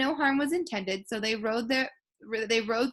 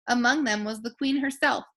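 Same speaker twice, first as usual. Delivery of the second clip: usual speaking voice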